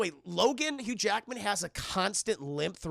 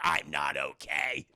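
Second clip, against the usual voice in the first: slow, low, worn-out croak; a hint of a chain-smoking old man; raspy old Logan; 5 semitones lower, slower than usual. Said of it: "sounds like an eighty-year-old chain-smoking bag lady".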